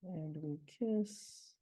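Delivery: slow, weight on "kiss"; slowed, rhythmic demo; anapestic beat. Shown as a demonstration. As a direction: let "And we kiss" land on kiss. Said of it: "very regular anapestic line".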